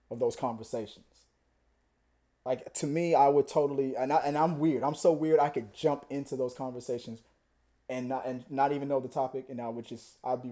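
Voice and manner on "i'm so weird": usual speaking voice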